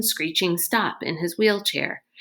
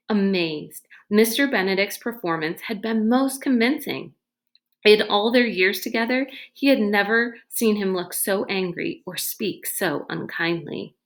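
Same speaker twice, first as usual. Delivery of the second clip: ordinary delivery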